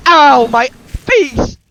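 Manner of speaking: loud, high, drawn-out cry; hammed-up howl of hurt; pained yell. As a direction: yell as if just bitten, stretching it out. Character bitten in the face.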